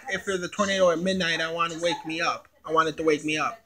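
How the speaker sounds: ordinary delivery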